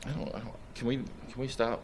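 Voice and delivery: small, strained begging; distressed plea. Real, not acted. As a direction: falter and plead to be let off. Distressed patient begs to stop session.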